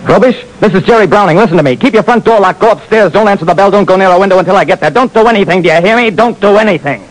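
detective warning the client by phone; urgent warning. Acted. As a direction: drive every order home.

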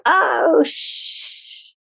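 high drawn-out oh fading into shh; retold stifled swear. Retold near-swear after dropping the bowl.

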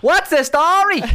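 put-on high, loud, drawn-out Dublin accent; McGregor. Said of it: "I went full McGregor".

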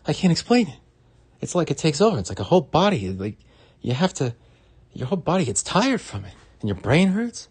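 high, loud, strained impression; Bill Burr. Explaining how exhausting the impression is, in a high loud comedian impression. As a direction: jump up high and belt it out. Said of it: "to do Bill Burr impressions".

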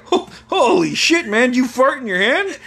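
high, incredulous questioning; imitated astonished dad. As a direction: ask it in amazed disbelief. Dad reacts to the prank.

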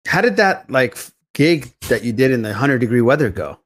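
ordinary talk, nothing marked

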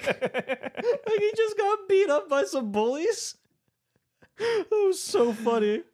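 very high, slow, helpless laughing speech. Real, not acted. Podcaster joking about the bullied actor, laughing. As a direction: lose it laughing mid-sentence. Cracking up retelling a funny scene.

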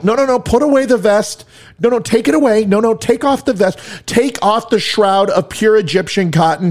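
high-pitched, sarcastic begging; mock pleading. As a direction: plead in exaggerated desperation, mocking weak negotiators. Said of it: "We are pleading with terrorists".

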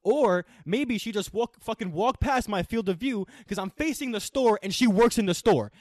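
loud, high sarcastic comeback; retold angry yelling. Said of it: "I was screaming at her".

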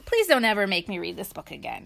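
usual speaking voice

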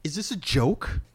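loud, high astonished question; stunned disbelief. Real, not acted. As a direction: blurt it out in shock. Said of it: "reacting to the trailer".

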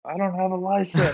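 high-pitched mock wail; hammed-up pleading reply; panicked confession. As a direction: act out a helpless admission to a cop. Acting out reply to cop.

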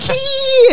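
very high squealing hee, imitating teen girls; mock girly giggle. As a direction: squeak a silly teenage giggle. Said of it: "and laughing and stuff".

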